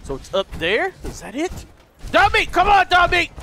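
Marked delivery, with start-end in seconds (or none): 2.1-3.3 high, loud summons; calling a dog. Pretend. Player calling Dog Meat to follow, calling out. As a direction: call your dog to come along.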